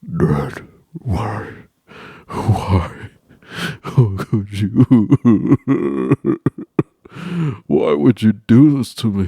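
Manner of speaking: hammed-up wail; betrayed whine. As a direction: accuse the father of betrayal. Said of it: "I'm over here".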